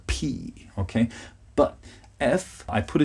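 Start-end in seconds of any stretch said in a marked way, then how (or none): 0.1-0.7 "P" with lips pressed and a puff of air, quiet; soft P. Shown as a demonstration. Teacher demonstrating the P sound for learners. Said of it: "and blow air".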